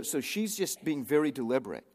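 usual speaking voice

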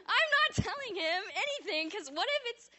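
high, strained protest; panicky protest. Retold or imitated. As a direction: refuse in a flustered rush. Retold panic about telling him.

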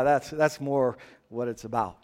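normal talking voice

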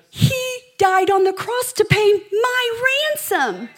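high, loud, soaring preacher's climax; preaching at full force. Acted. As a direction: lift the room as the rescue peaks.

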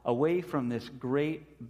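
ordinary talk

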